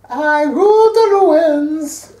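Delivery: put-on high, very slow German accent. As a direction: ham up a German metal accent. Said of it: "You gotta say it with a German accent".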